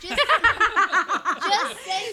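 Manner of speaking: very slow, loud words through a laugh. Real, not acted. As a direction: say it while cracking up. Friend bursts out mid-story.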